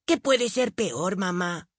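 put-on high, slow, curious little boy; young boy voice. A narrator's reading. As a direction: ask with childish wonder. Billy asks.

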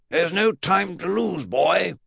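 put-on high, slow, eccentric old-relative voice; high-pitched, drawn-out urgent declaration; Mad Uncle Jack. Great-uncle urges the boy on, high eccentric voice.

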